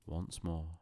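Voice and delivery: plain reading voice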